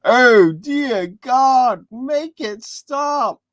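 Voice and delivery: high, slow, loud anguished plea; mortified groan. Acted. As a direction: drag it out like you want to vanish. Spoiler's mortified response.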